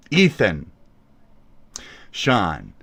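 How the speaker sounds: ordinary talk, nothing marked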